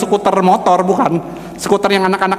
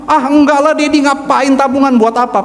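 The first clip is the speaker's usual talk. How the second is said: high-pitched dismissive protest; imitated protesting child; 9 semitones higher than usual. Son rejects Dad's savings offer, imitated high protest. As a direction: brush the offer off like a kid, pitched well up.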